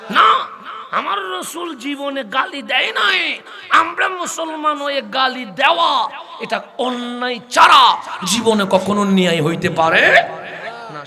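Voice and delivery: high, loud, drawn-out sermon cry; preaching at full force. Real, not acted. Preacher rousing the congregation at a mahfil, full-force sermon cry. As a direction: preach it out to the whole crowd.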